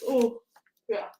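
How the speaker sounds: yawning mid-word; words stretched by a yawn. Real, not acted. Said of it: "Sorry, I'm yawning so much".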